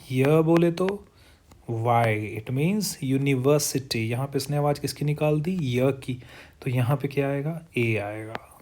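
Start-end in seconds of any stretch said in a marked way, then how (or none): none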